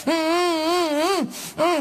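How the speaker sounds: hummed high through a taped mouth, slowly; muffled high hum. Acted. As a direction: mmm keras dari mulut terlakban. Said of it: "e a velha".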